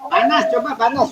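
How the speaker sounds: high-pitched name call; calling on someone. Real, not acted. Dosen memanggil Pak Anas menjawab. (memanggil orang dengan nada tinggi)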